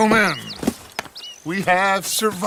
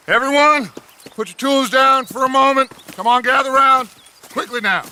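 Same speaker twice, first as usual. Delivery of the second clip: raised voice gathering everyone; calling the camp; 7 semitones higher, 3 dB louder than usual. Acted. Leader summoning the gang to gather, raised voice. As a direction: call them all in to listen.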